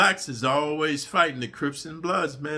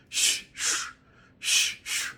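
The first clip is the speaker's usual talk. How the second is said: unvoiced shh scraping noise; imitated scraping; much slower than usual. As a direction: hiss out the scrape of the blade. Imitates sound of shank scraping.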